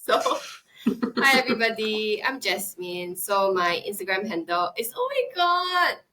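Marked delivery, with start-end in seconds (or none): 5.0-6.0 flustered surprise. Real, not acted. Forgets her own Instagram handle.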